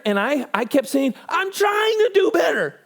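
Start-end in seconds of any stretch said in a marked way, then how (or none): 1.3-2.8 very high, strained pleading; desperate protest. Acted. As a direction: mock-desperate cry, pitched way up. Acted desperate reply to scolding God.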